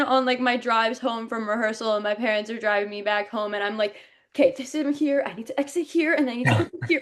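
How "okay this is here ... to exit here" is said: rapid, higher-pitched self-talk; hurried run-through aloud; rehearsing to oneself. Acted. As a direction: rattle through the blocking. Acting out rehearsing in car.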